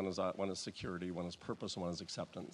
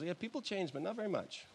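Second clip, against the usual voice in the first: higher, softer imitation of an older colleague; lightly voiced senior minister; 8 semitones higher, 5 dB quieter than usual. Senior minister to new preacher, lightly mimicked, high and soft. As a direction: gently mimic him, pitched up and quieter. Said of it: "a senior minister came to me".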